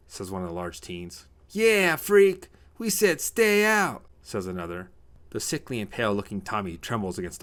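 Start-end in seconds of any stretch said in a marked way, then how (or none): 1.5-4.1 very high, loud taunt; jeering bully. Acted. Another teen joins in against Tommy, jeering. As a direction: drag out the jeer, high and loud.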